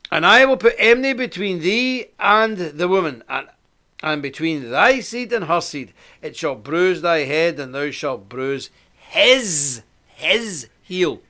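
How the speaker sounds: slow, solemn Bible reading; measured King James reading; scripture reading. Acted. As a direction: read the verse with gravity. Reads scripture aloud.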